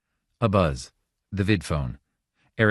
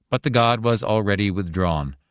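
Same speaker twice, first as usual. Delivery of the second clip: usual speaking voice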